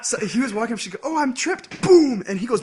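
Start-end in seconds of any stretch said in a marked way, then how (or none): 1.0-1.8 high-pitched mock surprise; hammed-up "oops" voice, pitched way up; feigned accident. Acting character's fake accidental trip. 1.8-2.2 explosive, high, very loud boom; sudden mock-impact shout; imitated impact. Imitating the kick landing.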